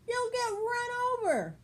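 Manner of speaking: shrieking very high, loud duck warning; a panicked mother duck scolding her mate; flustered squawk. Acted. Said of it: "squawked Missus Mallard, all of a dither".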